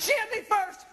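put-on high, childish shout of protest; whiny high protest. Telling the cops she started it, high childish whine. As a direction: whine it like a kid in third grade.

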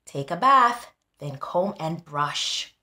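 ordinary delivery

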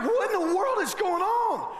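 baffled panic at the commotion; alarmed. Retold or imitated. Passenger reacting to the commotion, high alarmed disbelief. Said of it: "I'm like".

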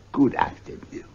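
under the breath, ending the talk; curt whispered dismissal. Acted. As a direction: wave him off coldly. Scrooge dismisses nephew curtly.

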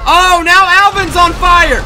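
loud, slow gleeful shout; excited. Real, not acted. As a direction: gloat loud over it. Gleeful at enemy burning.

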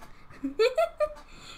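high-pitched giggle; short squeaky laugh. Real, not acted. Laughs at own joke.

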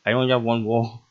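ordinary delivery

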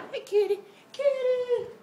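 high sing-song call to the kitty; high cat-calling voice. Real, not acted. Calling to the cat in a high, loud voice.

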